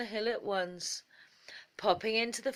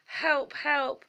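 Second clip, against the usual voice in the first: frightened cry for help; 8 semitones higher, 6 dB louder than usual. Acted. Rabbit chased by the fox.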